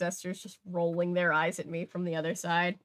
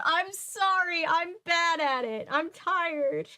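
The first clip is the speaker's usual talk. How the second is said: high, slower comic Irish voice; mock Irish apology; 9 semitones higher, slower than usual. Shown as a demonstration. Reader apologising to listeners in a joking Irish accent. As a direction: apologise in a hammed-up brogue. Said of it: "offended at the Irish accent".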